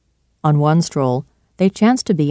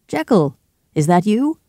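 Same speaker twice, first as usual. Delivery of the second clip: calling up to a window, a little higher; 6 semitones higher than usual. A narrator's reading. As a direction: call up to him, pleased. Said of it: "called out".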